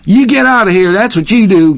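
high, loud gruff cook voice; angry cook. A narrator's reading. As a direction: order him out, bristling. Cook ordering him away.

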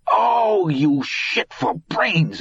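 high, drawn-out moan of self-reproach; sudden moan. A narrator's reading. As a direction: wail it at yourself, stretching the words. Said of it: "he suddenly moaned".

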